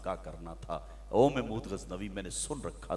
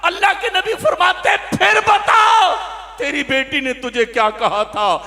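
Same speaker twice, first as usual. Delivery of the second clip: high, very loud, slow sung-out preacher's cry; fiery preaching; 23 dB louder than usual. Acted. Preacher crying out to the young man about his daughter, full force. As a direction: preach it at the top of your voice.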